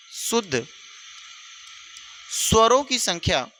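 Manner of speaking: teacher dictating notes word by word; dictation. Shown as a demonstration.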